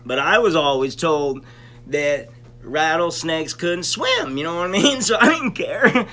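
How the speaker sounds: usual speaking voice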